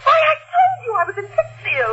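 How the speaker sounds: high-pitched, upset protest; indignant protest. Acted. Wife accused of murder by detective, indignant protest. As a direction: defend yourself, voice rising.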